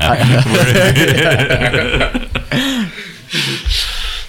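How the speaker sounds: long high laughter. Real, not acted. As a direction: laugh it out at length. Long laugh at the Steve quote.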